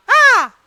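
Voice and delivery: a sudden high squeal of fright; high mock scream of surprise; startled girl's shriek. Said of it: "लड़कियां".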